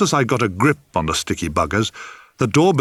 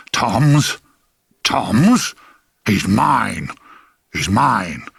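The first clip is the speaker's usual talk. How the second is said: angry outburst; much slower than usual. Acted. Grandfather clutching the baby against the family, angry outburst. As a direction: burst out in outraged disbelief. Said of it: "And Lear burst out".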